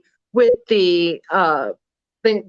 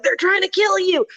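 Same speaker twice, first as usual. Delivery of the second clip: mock Greene panic; 10 semitones higher than usual. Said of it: "Marjorie Taylor Greene being like".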